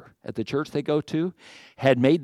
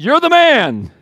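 "You're the man." shouted high and hard, stressing "man"; stern accusation; 10 semitones higher, 8 dB louder, slower than usual. Retold or imitated. Preacher gives Nathan's real words to David, loud and accusing. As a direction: nail him with "You're the man.". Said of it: "He said".

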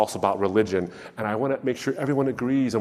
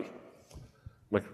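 usual speaking voice

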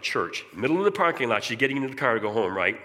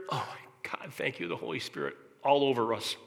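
usual speaking voice

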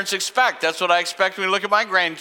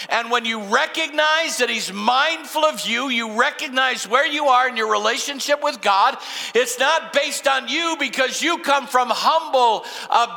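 normal talking voice

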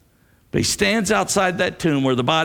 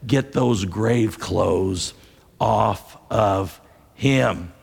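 slow command; 5 semitones lower, much slower than usual. Acted. Jesus command quoted.

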